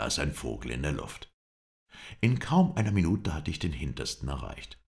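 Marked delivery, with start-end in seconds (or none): none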